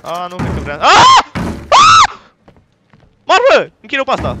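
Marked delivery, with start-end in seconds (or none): 1.7-2.2 very high, loud shriek; ear-splitting startled yelp; terrified scream. Real, not acted. Jump scare in game. 3.2-4.4 high, loud cry of fright; panicked yell. Real, not acted. Just screamed at a scare.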